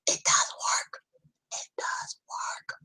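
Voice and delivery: breathy unvoiced whisper, slowing down. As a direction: hush it out like a secret plea. Mocking protesters' voice in quote.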